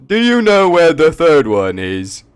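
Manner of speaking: high, loud goofy guard; dopey guard voice. Acted. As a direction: blurt it out like a clumsy guard. Guard NPC asks.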